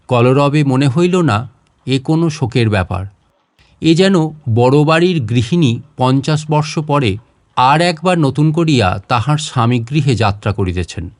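plain reading voice

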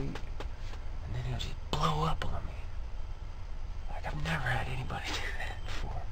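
quick whispered aside with a little laugh; breathless nervous whisper. Real, not acted. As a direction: whisper it fast so he can't hear.

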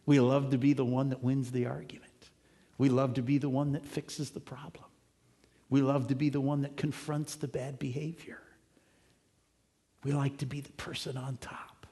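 hushed mock confession of loving to win; whispered. Preacher whispering to the congregation about loving to win, hushed. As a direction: whisper it like a guilty secret.

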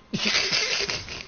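high breathy laugh burst. Real, not acted. Laughs at own joke.

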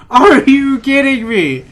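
slow, high disbelieving complaint; exasperated. Real, not acted. Gamer just died, slow exasperated disbelief. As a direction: drag it out in disbelief. Died after long effort.

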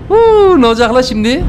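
high, loud whoop into a question; whoop, then wonder what's next; excited whoop. Real, not acted. Streamer at a tense turn, high loud whoop.

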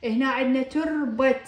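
usual speaking voice

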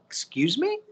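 high, rising incredulous question; mock disbelief. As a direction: toss it back as if taken aback. Retells reaction to clients' praise.

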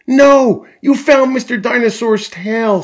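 high, loud delighted exclamation; thrilled mother, voice way up; Mummy Pig's excitement. A narrator's reading. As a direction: cry out at a happy discovery. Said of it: "says Mummy Pig".

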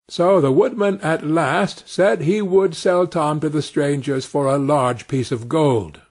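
ordinary delivery, nothing marked

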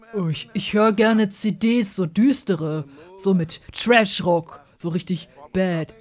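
slow, loud brooding deep voice; extremely deep bass voice. Acted. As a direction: growl it out deep and gloomy. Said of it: "ich muss ihn immer tief sprechen".

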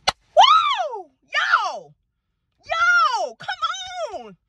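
high, drawn-out whoops of joy; jubilant celebration. Real, not acted. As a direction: cry out in triumph, pitch soaring. Celebrating news of getting house.

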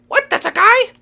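high, loud squeak of disbelief; surprised rabbit. Pretend.

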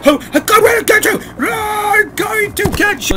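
shrill high screaming villain, slowed. Acted. Streamer voicing the villain at his enemy, in a shrill high scream. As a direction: howl it out like a cartoon villain.

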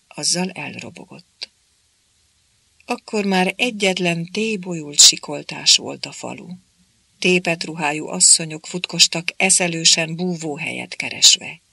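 ordinary delivery, nothing marked

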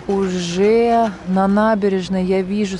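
ordinary delivery

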